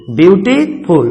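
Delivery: ब्यूटीफुल slowly, as ब्यूटी, short break, फुल; split into syllables. Shown as a demonstration. Teacher counting two syllables for students, ब्यूटीफुल slowly split in two. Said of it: "इसमें two syllable हैं".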